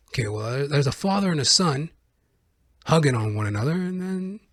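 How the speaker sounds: ordinary talk, nothing marked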